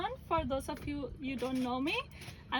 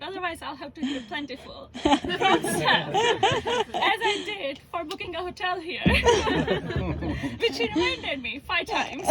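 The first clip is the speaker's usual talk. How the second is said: laughing; 2 semitones higher, 8 dB louder than usual. Real, not acted. Laughing through wedding speech.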